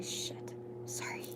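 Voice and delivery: a soft whispered apology under the breath; whispered sorry. Real, not acted. Reader whispers an apology to someone nearby, hushed. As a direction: breathe it out so the viewers barely hear.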